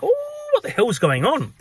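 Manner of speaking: high-pitched mock bewilderment; taken aback. Staff reacting to a difficult customer, taken aback.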